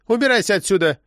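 high-pitched agitated order; demanding. A narrator's reading. Armed woman sends the agent away, demanding. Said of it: "потребовала Петти".

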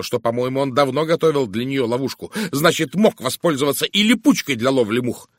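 combative woman character. Acted. Woman defending her accusation to the detective, combative. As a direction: argue back heatedly, refusing to budge. Narrator voices indignant Miss Howard.

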